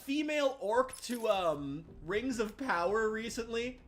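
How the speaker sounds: high, loud excited outburst. Real, not acted. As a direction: blurt it out, thrilled and shocked. Excited sudden realization.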